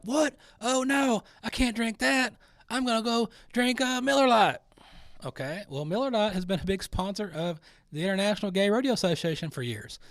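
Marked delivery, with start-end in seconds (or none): 0.0-4.6 much higher, louder mock panic; mock shock. Mocking boycotters panicking over Coors sponsoring Pride. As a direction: gasp like the beer betrayed you. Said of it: "And then they're like".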